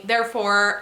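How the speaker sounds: ordinary talk, nothing marked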